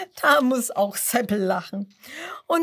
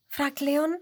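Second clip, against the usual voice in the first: ordinary delivery, nothing marked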